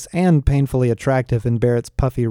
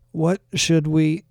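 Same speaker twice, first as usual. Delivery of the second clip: halting, higher-pitched struggle to speak; laboured, drawn-out question; slow, pained words; 4 semitones higher, slower than usual. A narrator's reading. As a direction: force each word out slowly. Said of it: "pained words out".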